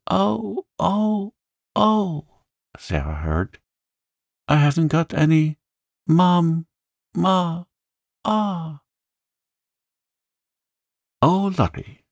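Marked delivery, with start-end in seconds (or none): 0.1-2.3 high, drawn-out wailed ohs; child's wail. A narrator's reading. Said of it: "Lottie howled". 4.5-8.8 put-on high, broken-up little-girl wail; wailing child. A narrator's reading. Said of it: "angry wails of some child". 11.2-12.0 high-pitched flustered cry; mild scream. A narrator's reading. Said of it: "screamed Miss Amelia".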